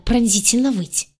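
ordinary delivery, nothing marked

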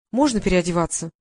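usual speaking voice

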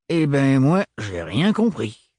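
put-on gruff old man, bemused and slow; baffled Renard. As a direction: shrug it off, puzzled. Narrator voices Renard.

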